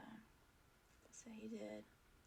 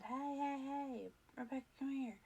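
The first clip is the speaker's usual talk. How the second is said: raised, higher call across a crowd; 5 semitones higher, 3 dB louder than usual. Retold or imitated. Friend at the festival calling Rebecca over, raised call. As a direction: hail her from a distance, mock loud.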